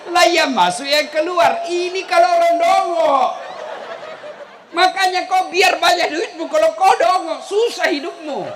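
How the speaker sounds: loud, high fiery preaching; preaching at full force. Acted. Preacher scolding the foolish rich to the children. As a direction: drive the lesson home to the crowd.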